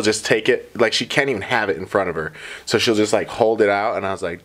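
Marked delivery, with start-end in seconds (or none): none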